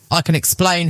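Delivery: plain reading voice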